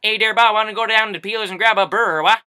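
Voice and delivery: hammed-up Newfoundland accent. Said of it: "he gave me an example of a Newfie sentence".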